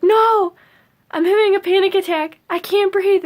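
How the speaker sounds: high, loud, rushed panic; answering a coworker mid panic attack, loud and high; panicked. Retold or imitated. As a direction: blurt it out fast, breathless with panic. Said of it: "And I was like".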